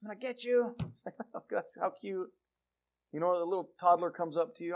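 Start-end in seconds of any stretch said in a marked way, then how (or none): 0.0-0.8 put-on high, small toddler threat. 1.3-2.3 put-on high, soft coo at a toddler. Cooing at toddler.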